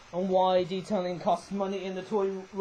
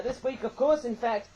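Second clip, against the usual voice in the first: ordinary delivery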